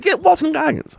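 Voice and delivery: very high-pitched protest; hammed-up high voice of complaint; exasperated mock outcry. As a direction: squeak out an exaggerated, fed-up plea. Repeating acted annoyed reaction.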